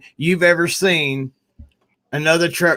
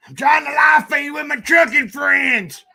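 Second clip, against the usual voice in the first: calling out; 11 semitones higher than usual. Real, not acted. Vlogger shouting at his mother to hush during the live feed.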